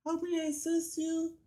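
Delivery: high, polite mock service voice; squeaky customer service greeting; drive-thru worker voice. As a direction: put on a sweet high drive-thru clerk voice. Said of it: "the person comes up".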